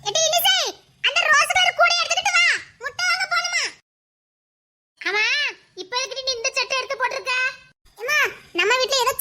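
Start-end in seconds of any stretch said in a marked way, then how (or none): 0.1-3.6 raised calling voice, higher and louder. Acted. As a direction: call her from the next room. Calling a child across house. (உரத்த குரலில் கூப்பிடுதல்)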